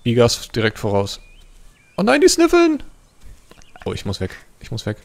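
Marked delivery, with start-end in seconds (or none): 2.0-2.8 very high panicked squeal; alarmed outcry. Real, not acted. Player realises predators are sniffing him out, alarmed. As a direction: cry out in high-pitched dismay.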